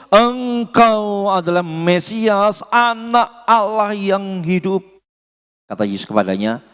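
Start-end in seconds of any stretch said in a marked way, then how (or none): 0.1-4.8 solemn confession. Acted. Peter's confession read with emphasis.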